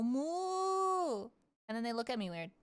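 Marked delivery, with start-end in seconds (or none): 0.0-1.3 a much higher, playful imitated moo; mock cow moo. Running up to the cows to greet them with a playful moo. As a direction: call out to the cows like one of the herd. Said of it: "and I'll go".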